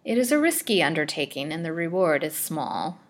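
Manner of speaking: ordinary delivery, nothing marked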